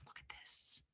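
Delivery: barely audible, voiceless whisper; fully whispered marvelling at a find; awed whisper. Real, not acted. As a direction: whisper it like a precious secret. Repeats it in a whisper.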